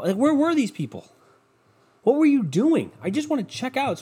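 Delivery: high-pitched incredulous griping; exasperated complaint. Retold or imitated. Customer griping at idle staff at the register, high and exasperated. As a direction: pitch jumps up in disbelief.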